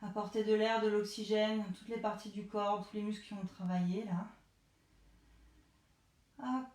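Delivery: soft, low relaxation coaching; calm them down gently; guided relaxation. Acted. Leads the cool-down breathing.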